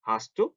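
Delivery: "hast du" joined as "hastu"; naturally linked. Shown as a demonstration. Teacher modelling for learners, linked pronunciation. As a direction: merge the t and d of "hast du" into one sound. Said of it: "자연스럽게 발음이어서".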